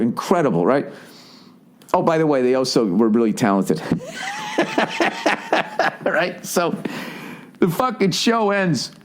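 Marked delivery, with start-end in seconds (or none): 3.9-6.0 high, long run of laughter; real laugh. Real, not acted. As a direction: crack up at your own remark. Laughs at own remark.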